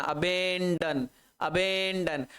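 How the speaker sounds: अबेंडन said slowly with a long stretched middle; slow teaching take of अबेंडन; drawn-out syllables. Shown as a demonstration. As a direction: hold the middle syllable of अबेंडन, a-beeen-dan. Teacher stretches the new word.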